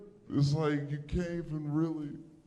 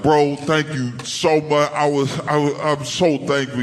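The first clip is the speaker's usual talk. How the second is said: gushing gratitude; 4 semitones higher, 7 dB louder than usual. Retold or imitated. Retelling his gushing thanks to rescuer.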